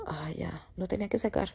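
hushed, exhaled relief; soft sigh of letting go; relieved sigh. Acted. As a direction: let it out softly, as if unburdened. Acting relief after a cry.

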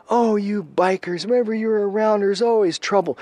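high-pitched scolding woman's voice; put-on voice of a fed-up woman. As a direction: complain high and insistent, telling them off. Voicing a young woman scolding bikers.

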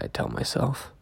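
plain reading voice

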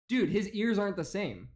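ordinary delivery

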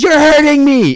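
high, loud mock cry of pain; fake distress. Girlfriend faking pain in public as a prank, high loud mock cry. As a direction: pretend to be hurt so the whole store hears. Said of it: "She goes".

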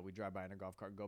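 normal talking voice

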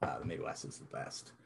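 whispered aside. Real, not acted. Aside after loving Ohio.

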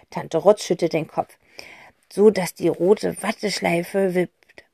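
usual speaking voice

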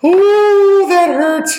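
very high, loud pained ooh; pained yelp. Acted. Player reacts to taking necrotic damage, high pained squeal. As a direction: squeal it high, stung by the hit.

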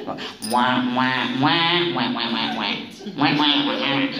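low droning "wah wah"; low wah-wah of unintelligible talkers; mimicked droning talk. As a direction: mimic meaningless chatter in a low monotone. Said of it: "they're talking, right?".

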